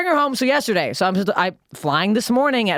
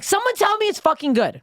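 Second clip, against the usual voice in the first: exasperated. Retold or imitated. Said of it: "I'm like".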